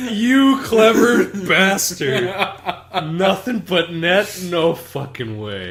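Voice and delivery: high, laughing, slowed-down amazement; cracking up at a clever pun; laughing disbelief. Retold or imitated. Cracking up at a stream chat joke, laughing. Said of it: "I'm like".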